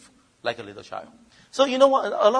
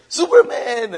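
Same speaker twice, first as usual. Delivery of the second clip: loud cry of Superman; playful hero yell; shout; 7 semitones higher, 15 dB louder, slower than usual. Retold or imitated. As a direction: call it out like a kid playing hero. Child shouting in story.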